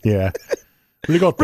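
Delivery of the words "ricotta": "Ricotta" said ree-GOT-ta, Italian style; "Ricotta" with rolled Italian vowels; Italian pronunciation. Shown as a demonstration. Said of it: "I say ricotta instead of ricotta".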